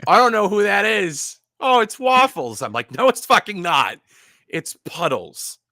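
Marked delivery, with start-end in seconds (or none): 0.1-2.6 high, loud coworker imitation; salty Maine woman. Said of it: "I can imagine the accent". 3.0-5.6 high, loud mock outburst; retold exasperation.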